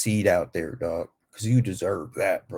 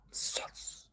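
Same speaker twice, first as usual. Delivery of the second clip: whispered sus; 16 dB quieter than usual. Pretend. Whispered aside.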